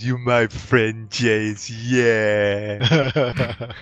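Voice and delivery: slow slurred fondness; sappy drunk leaning in. Acted. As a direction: lean in and gush like a drunk. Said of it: "leans in and goes".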